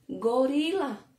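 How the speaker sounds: normal talking voice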